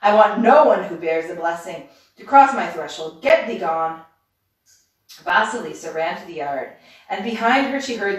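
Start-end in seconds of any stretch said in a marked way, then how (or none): 0.0-4.0 loud, raging witch banishing someone; narrated shriek. A narrator's reading. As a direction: order them out at full volume. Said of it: "she shrieked".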